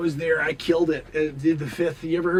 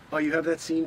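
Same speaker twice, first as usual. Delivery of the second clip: ordinary delivery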